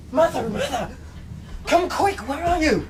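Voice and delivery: high, urgent call for mother. Acted. Boy calling his mother to the dying captain, urgently. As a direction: shout for help upstairs.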